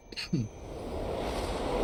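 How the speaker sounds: real cough from choking on water; sudden coughing fit. Real, not acted. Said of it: "choking on some water".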